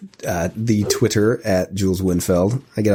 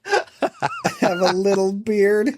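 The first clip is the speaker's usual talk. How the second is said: mocking high voice; 12 semitones higher, slower than usual. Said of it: "mocking repeat of beard line".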